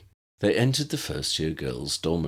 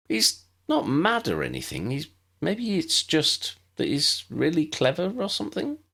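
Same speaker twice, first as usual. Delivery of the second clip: lightly voiced young girl, higher and curious; a hint of a polite first-year; Lydia the new girl; 8 semitones higher than usual.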